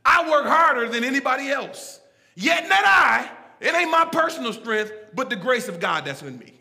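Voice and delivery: loud, driving pulpit delivery; full-force preaching. Real, not acted. Preacher driving home grace to his congregation, full-force preaching. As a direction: preach it hard, punching key words.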